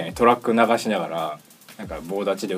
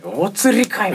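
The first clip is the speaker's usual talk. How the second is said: much higher, louder comic retort; indignant retort; 12 semitones higher, 6 dB louder, much slower than usual. Retold or imitated. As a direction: snap back at needing change. Retort about the change.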